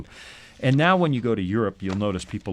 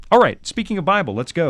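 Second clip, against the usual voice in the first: loud, high burst of energy; excited; 7 semitones higher, 5 dB louder than usual. Real, not acted. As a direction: jump back in with gusto. Energetic restart of the show.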